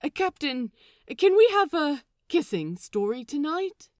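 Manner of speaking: high, loud, overeager ask; eager plea. A narrator's reading. Lucius begging the captain for a kissing story, overeager. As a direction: blurt it out too keen, trying to sound casual. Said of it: "super not weirdly".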